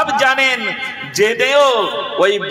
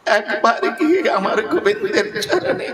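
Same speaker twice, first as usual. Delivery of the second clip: high, breaking, choked voice; tearful plea; 3 semitones higher than usual. A narrator's reading. Bidurani begs her husband to approach Govinda, tearful plea. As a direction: plead while choking back sobs.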